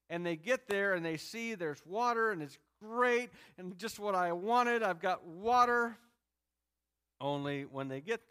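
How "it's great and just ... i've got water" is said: higher, louder rush of relief; imitated joy, building louder; mock delight. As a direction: act out thrilled discovery. Voicing Israelites delighted at finding water.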